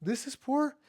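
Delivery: high disbelieving squeak, pitch climbing; incredulous. Retold or imitated. Kid refugee amazed at hosts calling pizza life poor, incredulous. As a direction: replay a kid's amazed disbelief at the word poor. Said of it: "and I'm like".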